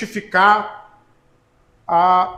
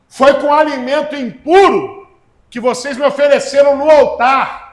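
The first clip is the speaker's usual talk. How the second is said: leitura pregada; 4 semitones higher, 5 dB louder than usual. Acted. Preacher reads God's words in Malachi.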